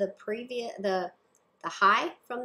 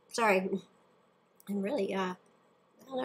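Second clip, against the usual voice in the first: throat catching, a little strained; talking through a tickle in the throat; frog in throat. Real, not acted. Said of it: "got something in my throat".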